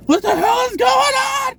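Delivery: very high, shouted mock panic; hammed-up shriek of alarm from inside your head; freaking out. The other conversation in her head, freaking out. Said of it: "Like, I'm freaking out".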